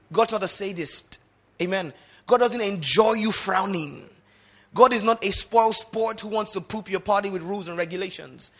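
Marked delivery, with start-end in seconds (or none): 2.2-8.5 loud, ringing sermon climax; preaching at full force. Real, not acted. Preacher to congregation, loud impassioned preaching. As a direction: drive it home over the music.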